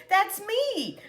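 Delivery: laughs out the words, pitch jumping high; bursting into real laughter as it dawns; laughing. Real, not acted. Realizing she's being imitated.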